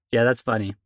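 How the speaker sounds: usual speaking voice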